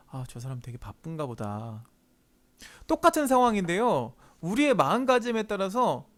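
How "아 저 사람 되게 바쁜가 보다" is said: soft, low musing to oneself; quiet, lowered acted aside; inner thought. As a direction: think it aloud, unbothered. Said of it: "아니면 속으로".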